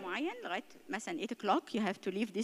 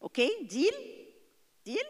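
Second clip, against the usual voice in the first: slow, higher, emphatic deal; sealing a deal; 7 semitones higher, slower than usual. Shown as a demonstration. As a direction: close the deal with the kid. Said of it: "كل واحد وسن ولاده".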